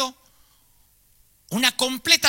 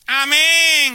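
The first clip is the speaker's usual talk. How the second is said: loud cry of amen from the crowd; old woman shouting amen; 7 semitones higher, 7 dB louder than usual. Acted. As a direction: shout it out from the back. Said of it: "gritó una vieja". (una vieja grita amén en voz alta)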